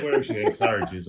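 ordinary talk

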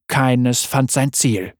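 plain reading voice

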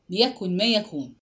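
usual speaking voice

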